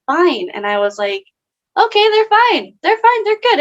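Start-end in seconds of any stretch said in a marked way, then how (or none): 1.8-3.6 much higher, louder singsong okay; sarcastic mock reassurance. Mocking friends who pretend they're fine, sarcastic. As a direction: brush it off in a mocking high voice.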